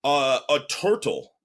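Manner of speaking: put-on slow, drawn-out Russian accent, hesitant "Uh"; Russian-accented broken English, unsure; thick Russian accent. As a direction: imitate a Russian kid answering in a heavy Russian accent. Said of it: "With, like, a thick Russian accent".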